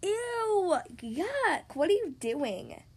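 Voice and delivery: grossed-out "ew, yuck"; disgusted. Acted. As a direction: squeal it in disgust. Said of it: "they said".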